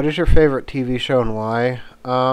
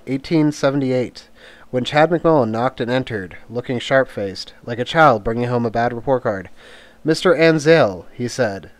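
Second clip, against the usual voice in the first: story narration, a little louder, read from a novel; reading a book aloud; 5 dB louder than usual. Acted. Reads from American Gods.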